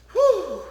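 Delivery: high, loud delighted whew. Acted. Reviewer imagining the car leather, a loud high delighted whew. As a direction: let out a thrilled whistle of breath.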